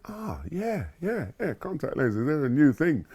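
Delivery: a mild hint of a pleased father; approving dad. Dad approves, voiced by son.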